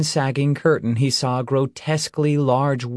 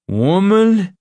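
put-on drunk slurring; 9 semitones higher, 7 dB louder than usual. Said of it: "his speech slurred".

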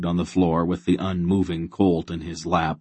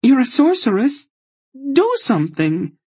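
high, loud, desperate demand; begging her to save the foal; desperate plea; 6 dB louder than usual. A narrator's reading. Desperate child pleads with sorceress.

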